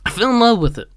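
gushing. Real, not acted. Gushing about the show.

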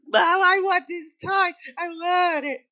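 put-on very high bratty kid voice; whining child. Child demanding a toy from parent, whining. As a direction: imitate a child wailing for a toy. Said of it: "you can't say no. It's like".

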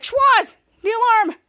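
high, loud alarmed call; urgent call. Retold or imitated. Ray alerts Schwartz to the alarm, urgent shout. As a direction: call out a warning.

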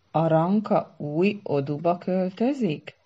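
slow incredulous question, "új" stressed; ominous suspicion. A narrator's reading. Szara asking if Aranka moves burrow, ominously suspicious. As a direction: draw it out, leaning hard on "új". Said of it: "kérdezte vészterhes, gyanakó hangon".

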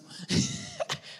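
sudden high-pitched laugh. Real, not acted. Preacher laughs at own joke.

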